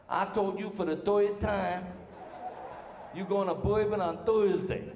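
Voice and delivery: put-on slow, stretched Southern-Brooklyn accent; exaggerated Southern-meets-Brooklyn accent; half Southern, half Brooklynese. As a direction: drag out a New Orleans Brooklynese drawl. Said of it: "They talk half Southern, half Brooklynese".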